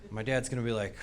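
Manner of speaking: usual speaking voice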